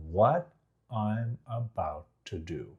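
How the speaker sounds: soft, slow, word by word; suspenseful slowdown. Shown as a demonstration. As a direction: drop away and keep them guessing. Demonstrating unpredictable variation.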